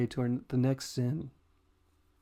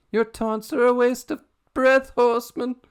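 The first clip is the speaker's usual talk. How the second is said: put-on high, loud German doctor accent; 12 semitones higher, 10 dB louder than usual. Pride dismissing Fury in a German doctor accent. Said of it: "I went almost German doctor again".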